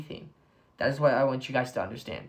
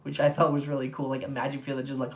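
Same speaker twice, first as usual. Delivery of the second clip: ordinary delivery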